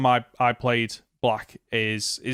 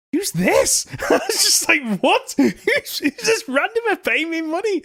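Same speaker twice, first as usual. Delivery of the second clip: baffled surprise; 14 semitones higher than usual. Retold or imitated. Retold baffled PayPal reaction.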